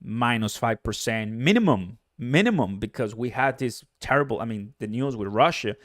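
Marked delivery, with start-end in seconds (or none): none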